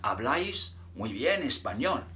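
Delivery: Spanish said by an English speaker; Spanish demo. Shown as a demonstration. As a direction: say it as a Spanish phrase. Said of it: "I'd say something like".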